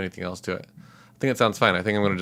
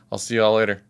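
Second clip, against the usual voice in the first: ordinary talk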